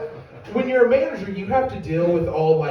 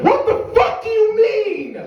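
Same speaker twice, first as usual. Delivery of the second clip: very high, loud, stretched-out yell; outraged shriek; 6 dB louder, slower than usual. Acted. Customer and manager clash over remade burgers, screaming outrage. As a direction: explode in slow, screeching disbelief.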